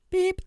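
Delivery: single piercing electronic beep; card machine beep. Retold or imitated. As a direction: sound the card reader's error tone. Imitates card machine beep again.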